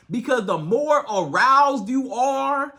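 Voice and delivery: usual speaking voice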